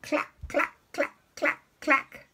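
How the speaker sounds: high, staccato clucks; quick separate chicken clacks. Retold or imitated. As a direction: imitate a hen in short high bursts. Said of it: "what does the chicken say".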